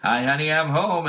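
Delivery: a hint of a hammy TV husband; sitcom husband. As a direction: redo the entrance for the laugh track. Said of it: "comes back in".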